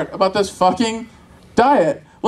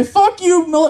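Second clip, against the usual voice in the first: higher-pitched mock-angry parental outburst; scolding parent; 7 semitones higher, slower than usual. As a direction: snap at the kid in mock fury. Parent scolding imaginary children.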